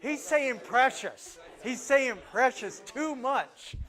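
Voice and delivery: high, loud, drawn-out mock alarm; mock excited anticipation. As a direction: play an audience member bursting with suspense. Voices the audience's excited anticipation.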